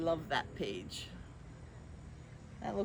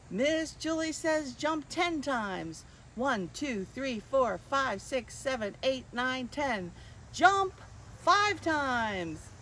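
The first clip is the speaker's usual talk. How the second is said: higher, bouncy game-caller voice; game leader; 5 semitones higher, 3 dB louder than usual. Acted. Miss Julie calling commands to children, playful. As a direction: keep the kids guessing. Leads Simon Says game.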